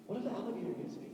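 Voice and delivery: very soft, fast fretful question; a hint of a scared son; worried kid. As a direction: ask it small and nervous. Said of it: "he's like".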